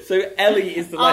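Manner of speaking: shouting through laughter; laughing. Real, not acted. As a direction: laugh while you shout it. Host laughing through confusion.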